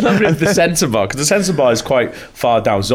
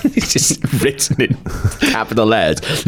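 ordinary talk

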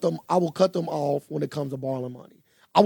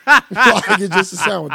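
laughing; 5 semitones higher, 9 dB louder, slower than usual. Real, not acted. Laughing while retelling.

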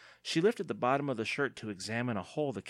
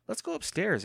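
high, insistent prompt; urging; 11 semitones higher, 3 dB louder than usual. A narrator's reading. Andy pushes him toward the stairs, urging. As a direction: press him to get moving. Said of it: "Andy urged".

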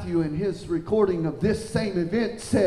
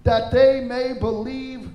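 higher, projected preacher's voice; raised, declarative Bible reading; scripture reading; 6 semitones higher, 3 dB louder than usual. Acted. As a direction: read the prayer out to a congregation. Reads scripture to congregation.

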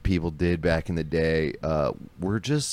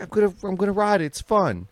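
fast, higher excited defense; high giddy husband; 13 semitones higher than usual. Retold or imitated. Husband defending Vespa, fast high giddy. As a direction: defend the scooter eagerly. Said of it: "He's like".

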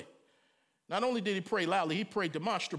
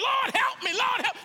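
much higher, louder desperate plea; crying out; 14 semitones higher, 6 dB louder than usual. Retold or imitated. Walking alone, crying out loud to God for help. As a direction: cry out to God, pleading. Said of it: "I'm walking".

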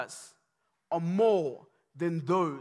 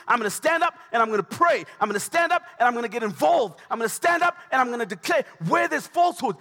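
loud, high, driving sermon cadence; rally the church, building fervour; loud charismatic pulpit preaching; full-force preaching; 5 semitones higher, 6 dB louder than usual. Real, not acted.

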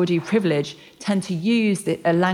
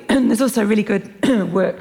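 clearing a croaky throat mid-sentence; frog in throat; 4 semitones higher, 5 dB louder than usual. Real, not acted. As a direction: push words past a catch in the throat. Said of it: "I got a frog in my throat".

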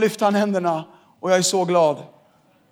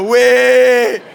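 mock cheer; 5 semitones higher, 10 dB louder than usual. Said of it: "Alla killar sa".

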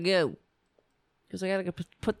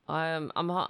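ordinary talk

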